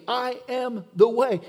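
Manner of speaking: slow, every word of "I am the way" leaned on, "the" hardest; emphatic. Acted. Preacher quoting Jesus to the church, slow and emphatic. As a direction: declare "I am the way" as the final word. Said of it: "Jesus is emphatic in saying".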